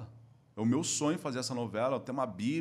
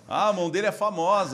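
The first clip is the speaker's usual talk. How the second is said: imitando a zoação; 5 semitones higher, 8 dB louder than usual. Retold or imitated. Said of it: "virou a maior tiração de sarro".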